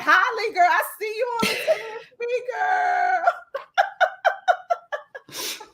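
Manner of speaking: put-on high, bubbly hey-girl call; playful high girlfriend greeting; excited greeting. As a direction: gush over a friend seen on TV. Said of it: "So I'm gonna be like".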